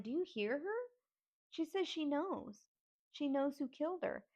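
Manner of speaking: high-pitched retold insistence; urgent appeal. Niece urging sister and aunt to hear the confession, insistent. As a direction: plead to be believed, pitched well up.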